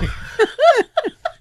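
loud high laughter. Real, not acted. Friend laughing after quoting Lucas, high laugh.

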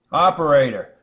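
hurried, loud call into the phone; quick call to operator. Acted. Reporter rushing the operator, loud and hurried. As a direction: grab the operator's attention fast. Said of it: "he said quickly before she could answer".

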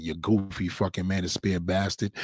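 normal talking voice

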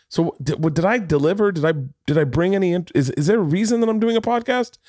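high, worried self-doubt; anxious self-questioning. Retold or imitated. Self-doubting inner voice quoted.

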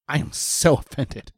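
slow, drawn-out mock offense; mock offended coworker. Imitating coworker warned about shrimp rolls, slowly offended. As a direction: imitate her taking offense, stretching each word. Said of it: "And she was like".

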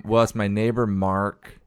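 usual speaking voice

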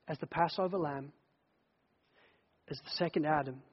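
tender. Acted. Quiet tender climax about Jesus.

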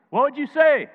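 high, loud demand; loud challenge. Acted. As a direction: call it out to the crowd. Preacher challenging congregation loudly.

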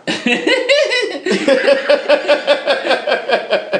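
goofy high hiccupping laugh; hiccup giggles. Real, not acted. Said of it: "That laugh, you heard how goofy that was?".

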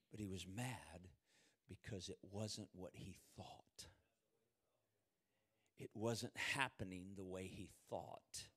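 low, soft, slow confiding; hushed preaching. Real, not acted. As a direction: pull them in with a near-whisper. Preacher drops to a hush.

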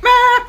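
high, loud "meh" of effort; imitated yanking noise. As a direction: mimic a hard tug with a noise. Imitates yanking the cord hard.

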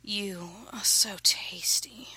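slow, whispery murmur; breathy murmur. A narrator's reading. Narrator to a lover, slow breathy murmur. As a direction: draw it out in a half-whisper.

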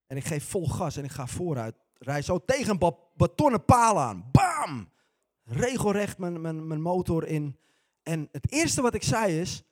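ordinary delivery

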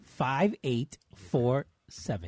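slow, spaced-out phone number. Shown as a demonstration. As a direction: read the number out slowly, digit by digit. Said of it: "can we say it slower".

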